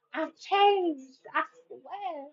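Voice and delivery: high, hammed-up plea; put-on pleading voice. Ex swearing they have changed, exaggerated pleading. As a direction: overact a desperate promise in a silly high voice. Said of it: "I have no idea why I'm talking like that".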